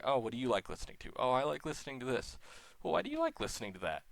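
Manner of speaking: lightly voiced friendly music chat; casual chat voices. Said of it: "it's a little more like".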